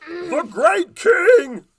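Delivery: high, loud and slow goblin cry; panicked goblin cry. Acted. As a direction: cry out in terror. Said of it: "he cried".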